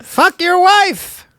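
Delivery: high, slow, lisping-free squeaky Wallace Shawn; Wallace Shawn impression. Friend mimics Wallace Shawn's high slow voice. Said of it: "It's like Wallace Shawn".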